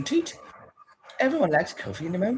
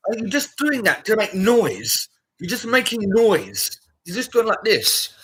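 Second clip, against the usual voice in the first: annoyed rant; 5 semitones higher, 6 dB louder, slower than usual. Real, not acted. Ranting at noisy creator.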